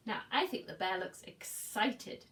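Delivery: ordinary delivery, nothing marked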